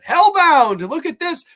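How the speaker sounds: name called out high and loud; excited shout-out. Real, not acted. Host greeting a viewer in chat, excited call. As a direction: hail the viewer like a friend arriving.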